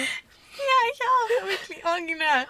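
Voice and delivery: high, laughing through the words. Real, not acted. Remembering the strict teacher with her friend, laughing high. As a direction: giggle it out.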